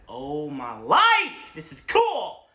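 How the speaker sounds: slow, loud marvelling; amazed kid. Retold or imitated. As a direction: gasp at the wonder. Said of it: "I was like".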